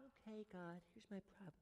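small, hushed prayer voice; a timid little report to God; meek. Shown as a demonstration. Pastor mocking a timid prayer, meek and hushed.

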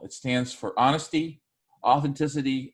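ordinary delivery

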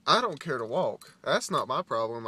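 loud, emphatic irritation; kinda mad. Real, not acted. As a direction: push back, annoyed at lazy people. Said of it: "that kinda makes me mad".

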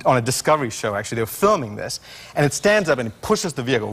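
ordinary talk, nothing marked

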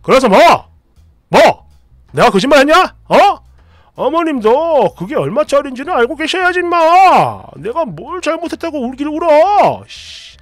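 loud, defiant yelling; shouting back. Retold or imitated. Husband shouting back at his crying wife, loud and defiant. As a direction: bark back without backing down. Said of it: "더 큰 소리를 칩니다".

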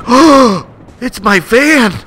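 high, loud exclaiming; excited alarm. Acted. Player scrambles for his van, high excited yelps.

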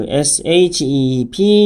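slow letter-by-letter spelling; slow teacher spelling. Shown as a demonstration. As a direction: spell out P E E L one letter at a time. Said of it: "spelling the word out".

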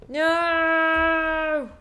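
loud, high shout of dismay; sharp shout. Real, not acted. Player yelling at the screen as the puzzle fails, dismayed shout. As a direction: yell as it goes wrong. Sudden failure in game.

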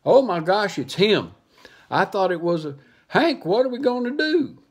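high, loud panicky voice; scared little dog pleading, high; panicked Drover. Acted. Little dog panicking to Hank, high and loud.

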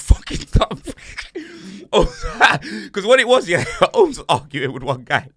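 words breaking up into laughs; cracking up. Real, not acted. Storyteller cracking up over friend's argument, laughing through the words. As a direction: talk through real helpless laughter.

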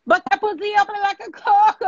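loud joke. Real, not acted. Crude joke on stream.

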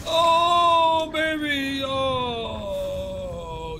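long high wail sliding down; drawn-out cry. Real, not acted. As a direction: stretch the vowels out high, then let them sink. Crowing over dazed game enemy.

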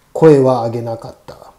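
ordinary delivery

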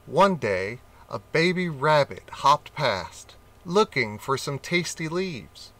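ordinary delivery, nothing marked

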